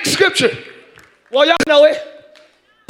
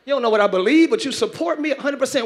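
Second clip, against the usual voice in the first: usual speaking voice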